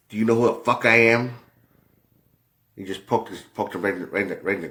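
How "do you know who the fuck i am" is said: louder, higher menacing challenge; arrogant threat. Retold or imitated. Jordan bullying the ball boy over a favor, angry threat. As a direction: lean in and intimidate.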